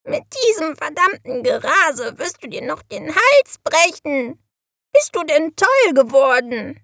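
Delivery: high-pitched indignant bird voice; scolding raven. A narrator's reading. Raven berates the witch for speeding, scolding. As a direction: scold away as an outraged raven. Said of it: "schimpfte der Rabe".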